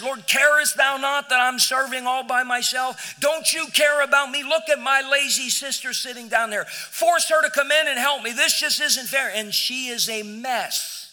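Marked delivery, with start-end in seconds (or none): 0.0-9.3 loud, high, indignant outburst at the Lord; shouting that it isn't fair; angry complaint. Acted. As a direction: play Martha fed up and demanding help. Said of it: "she's mad at the Lord".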